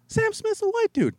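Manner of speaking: put-on squeaky kids scoffing at teacher; high mock students. Students quoted insisting.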